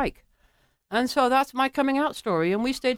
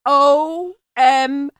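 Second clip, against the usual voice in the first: very slow, one letter at a time; stretched, emphatic amazement; awestruck; 3 semitones higher, 6 dB louder, much slower than usual. Retold or imitated. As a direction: draw out each letter loudly for effect. Stunned reaction to a first kiss.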